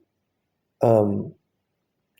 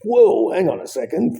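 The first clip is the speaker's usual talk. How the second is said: much higher, alarmed "whoa, hang on"; startled protest; 11 semitones higher than usual. Retold or imitated. As the doctor leaves the room, a much higher alarmed protest. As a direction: jump up in pitch, caught off guard. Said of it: "I'm like".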